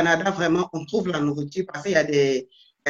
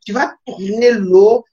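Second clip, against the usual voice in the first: slow, drawn-out mock Quebec accent; imitation québécoise; 2 semitones higher, 4 dB louder, slower than usual. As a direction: imitate a Quebecer giving directions, stretching the vowels. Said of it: "Il me dit". (accent québécois imité, lent et étiré)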